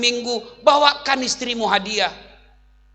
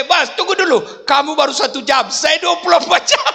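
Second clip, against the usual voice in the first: exasperated retort; 8 semitones higher, 4 dB louder than usual. Retold or imitated. Husband retorts to the jinn.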